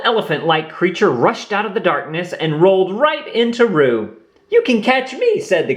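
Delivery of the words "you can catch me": little Heffalump. A narrator's reading. Lumpy speaks.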